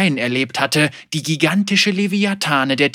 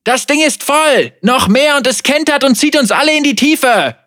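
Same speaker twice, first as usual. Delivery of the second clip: high, loud, desperate warning; panicked shouting; 12 semitones higher, 8 dB louder than usual. Acted. Man in the boat warning of capsizing, panicked shouting. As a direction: shout them back from the overloaded boat. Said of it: "rief ein Mann aus dem Bug".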